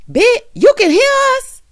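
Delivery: high, loud acted disbelief; hammed-up shriek of a question; mock outburst. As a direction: imitate a loud, incredulous comeback. Acts out her loud startled comeback.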